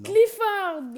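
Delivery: Clifford called out high and loud in a Québec accent. Real, not acted. Podcaster recalls the red dog's name, loud Québec accent. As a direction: blurt the name with a Québécois accent. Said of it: "Clifford avec mon accent de Québec".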